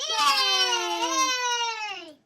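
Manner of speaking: put-on little girl cheering in triumph. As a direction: squeal with joy. Girl cheers wolf dead.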